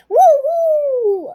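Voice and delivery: high, loud whoop; cheer. Acted. Child cheering as she grabs her sparkler. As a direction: whoop with joy. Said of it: "with a cheer".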